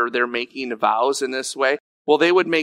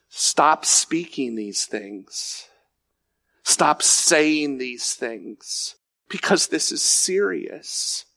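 very slow, weighted command; stern admonition; slower than usual. Acted. Preacher warning himself and congregation, slow stern admonition. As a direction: spell out the warning word by word. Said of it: "I am not yelling at anyone".

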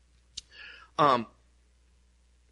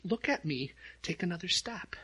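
softly and reassuringly; tender quiet encouragement; gentle father; 3 semitones higher, 11 dB quieter than usual. Retold or imitated. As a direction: lift them up gently. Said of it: "he's like".

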